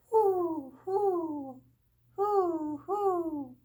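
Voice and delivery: high, loud hooting owl. A narrator's reading. Narrator voices the owl calling outside, loud high hoot. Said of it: "and someone calling".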